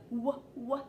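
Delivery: "w" said as a short "wuh", twice; letter sound demo. Shown as a demonstration. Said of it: "w sound at the beginning".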